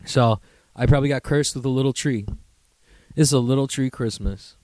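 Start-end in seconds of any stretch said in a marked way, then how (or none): none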